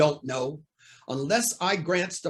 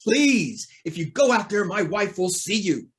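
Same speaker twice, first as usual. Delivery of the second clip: raised, anxious plea; urgent pleading; 3 semitones higher than usual. Acted. Judge begs Hornet to spare his ailing wife, pleading. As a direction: beg him not to go out there.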